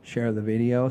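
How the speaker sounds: ordinary talk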